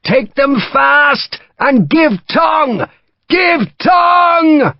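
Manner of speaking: high, slow, bellowed command; shouted order. Acted. Major driving his Irishmen into the charge, bellowing. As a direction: drive them on with a roar. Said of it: "he shouted".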